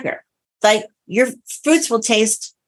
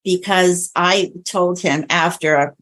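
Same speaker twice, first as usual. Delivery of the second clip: ordinary talk, nothing marked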